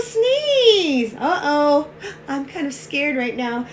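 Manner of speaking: ordinary delivery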